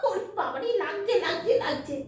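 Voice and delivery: high whining ouch; mock pain. Real, not acted. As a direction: whimper that it hurts, playfully. Dog hurting her.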